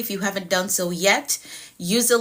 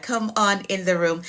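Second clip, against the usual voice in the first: usual speaking voice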